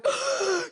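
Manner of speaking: sharp high gasp; shocked gasp. Retold or imitated. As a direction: gasp at the cold. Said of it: "me going".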